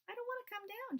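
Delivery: put-on a stubborn small girl up a tree; little girl voice. Little girl refusing the wise men, high and childlike.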